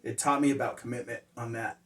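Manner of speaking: usual speaking voice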